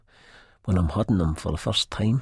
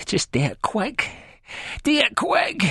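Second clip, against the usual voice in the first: a beaten man's groaning plea, rising much higher. A narrator's reading. Spud on the floor, begging Begbie to end it, groaning. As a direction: beg him to finish it, groaning. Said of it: "I groan".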